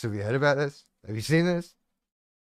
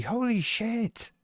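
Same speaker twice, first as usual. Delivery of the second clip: slow, higher, hushed holy shit; stunned mock statement; 7 semitones higher, 5 dB quieter, much slower than usual. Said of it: "Their comment is gonna be".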